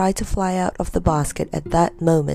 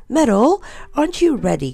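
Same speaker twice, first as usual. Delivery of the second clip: impatient aunt calling her nephew, raised pitch on the name; 6 semitones higher than usual. A narrator's reading. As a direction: call out with mild impatience. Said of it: "cried Aunt Jemima".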